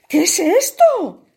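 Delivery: high, loud frightened cry. A narrator's reading. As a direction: gasp it out in a panic. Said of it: "se preguntó asustado".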